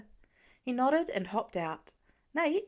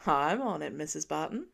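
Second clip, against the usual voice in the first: lower, playful mock-formal; husband teasing his wife with a salute; 6 semitones lower than usual. A narrator's reading. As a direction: tease her with a salute. Clint teasing voiced lower.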